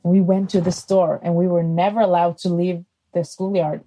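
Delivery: ordinary delivery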